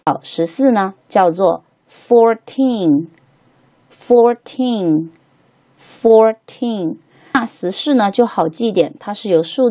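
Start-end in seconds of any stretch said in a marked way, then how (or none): 1.9-7.0 put-on fourteen split slowly, teen drawn out and falling; stress on TEEN. Shown as a demonstration. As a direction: say fourteen leaning on the final teen. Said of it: "T E N这个部分是必须要被重".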